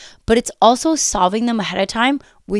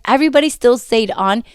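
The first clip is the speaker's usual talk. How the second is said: usual speaking voice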